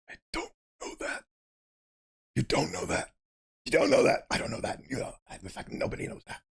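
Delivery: soft breathy whisper. Acted. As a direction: whisper it fearfully. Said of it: "Olivia whispers softly".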